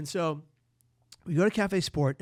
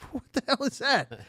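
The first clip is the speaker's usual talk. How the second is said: mock shocked question; 10 semitones higher, 7 dB louder than usual. Margaret seeing the duffel bag.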